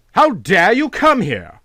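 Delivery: furious demand at an intruder. A narrator's reading. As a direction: spit it out in fury. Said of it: "asked Van Cheele furiously".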